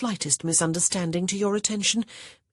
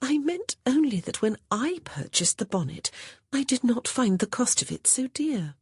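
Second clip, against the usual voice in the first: innocent, sweet, higher voice; sweetly; 8 semitones higher than usual. A narrator's reading. Honor answering Augustin's accusation, sweetly innocent. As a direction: feign innocence with a sugary tone. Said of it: "Honor said sweetly".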